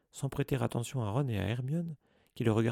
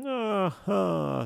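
loud, much higher triumphant cry; 9 semitones higher, 6 dB louder than usual. A narrator's reading. Rolling ghost head catching Harry out, crying out. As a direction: pounce on the answer gleefully. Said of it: "s'écria la tête".

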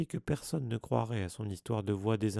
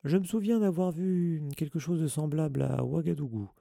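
a lightly higher, boastful Lockhart; Lockhart's voice; 6 semitones higher, 4 dB louder than usual. A narrator's reading. To Dumbledore, a boastful Lockhart brags. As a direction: show off about your travels.

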